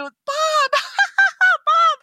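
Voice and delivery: laughing out the name, high; laughing repeat. Retold or imitated. Friends hearing the stranger's name, laughing high. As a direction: crack up on the name.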